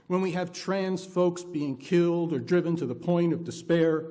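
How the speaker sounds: normal talking voice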